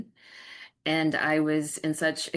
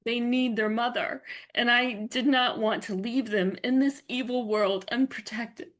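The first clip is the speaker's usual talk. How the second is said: higher, tear-choked voice holding on; tearful; 6 semitones higher than usual. Real, not acted. Guest telling the host about her children, tearful and choked. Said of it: "I'm gonna try not to cry".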